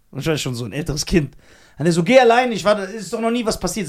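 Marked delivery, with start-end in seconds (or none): 2.0-3.9 louder, higher mock of a fed-up dad; fed-up father telling his child to go alone; annoyed father. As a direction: brush the kid off, irritated.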